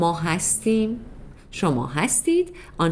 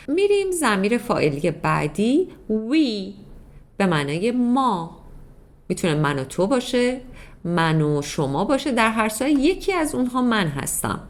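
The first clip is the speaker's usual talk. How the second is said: usual speaking voice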